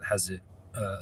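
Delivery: normal talking voice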